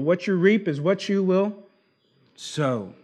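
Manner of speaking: ordinary talk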